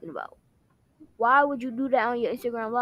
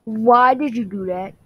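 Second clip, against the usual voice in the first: angry; 4 semitones lower, 8 dB louder than usual. Acted. Acted quarrel, confronting.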